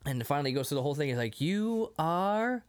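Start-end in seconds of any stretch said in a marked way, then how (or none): 1.4-2.6 high, slow prompt with a long pause; prompting a learner. Retold or imitated. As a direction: leave it hanging, then land the name high. Retold name lesson, getting it wrong.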